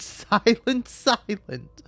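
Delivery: high giggling repeat of silent; maniacal laugh. Acted. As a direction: cackle through the words. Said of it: "maniac voice".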